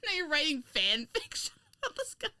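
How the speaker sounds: high, laughing through the words; real giggling disbelief; laughing protest. Real, not acted. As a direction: protest while cracking up. Cracking up at the teasing.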